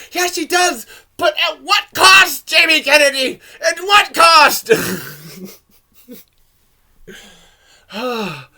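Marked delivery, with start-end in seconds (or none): 0.1-4.7 high, loud and slow theatrical yell; loud, high, drawn-out hammed-up outrage; mock-dramatic shout. Real, not acted. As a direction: bellow it like a tragic hero.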